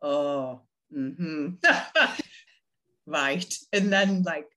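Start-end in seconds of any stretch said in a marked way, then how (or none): 1.6-2.2 short high laugh; high laughing burst. Real, not acted. Laughing at her own story, high burst.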